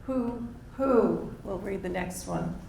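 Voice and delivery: usual speaking voice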